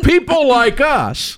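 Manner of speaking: high, drawn-out mimicked whine; mock lament. Host mimics people making excuses, high mock lament.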